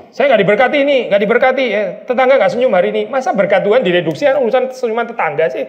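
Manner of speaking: mock grumble about a neighbour's missing smile; mock whiny complaint. Mocking a complaining believer.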